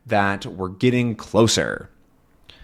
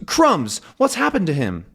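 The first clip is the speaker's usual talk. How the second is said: high, alarmed exclamation; Tintin alarmed; 7 semitones higher than usual. Acted. Tintin sees the captain fall, alarmed and high. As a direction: sound caught off guard. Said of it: "Tintin goes".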